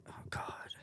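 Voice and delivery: breathy whisper of "oh God"; whispered dread. Retold or imitated. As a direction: whisper it like bad news is coming. Dreading the producer's DM.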